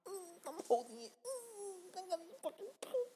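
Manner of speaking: very high, soft, squeezed whimper; desperate straining. Retold or imitated. Man walking to the toilet holding it in, high strained whimper. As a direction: squeak it out while holding everything in.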